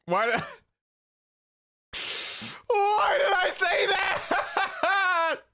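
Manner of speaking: shrieking it through laughter, slow; laughing high mock wail. Streamer mocking cohost, shrieking through laughter. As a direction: howl the line while cracking up.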